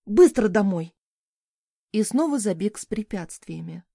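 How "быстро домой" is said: a louder, urgent growl of a command; an urgent, loud order to get home. A narrator's reading. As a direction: bark them home in a hurry. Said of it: "рыкнув".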